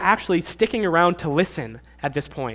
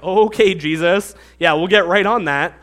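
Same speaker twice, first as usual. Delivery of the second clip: louder, eye-rolling mock agreement; sarcastic; 4 dB louder than usual. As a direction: brush him off with fake eagerness. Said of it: "The tone is almost sarcastic".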